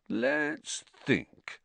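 high, softened, drawn-out pondering; sarcastic show of thinking; mock puzzlement. A narrator's reading. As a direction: pretend to rack your brains. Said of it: "said Ron in mock puzzlement".

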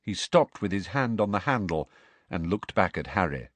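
ordinary delivery

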